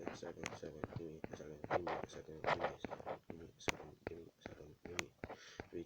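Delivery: usual speaking voice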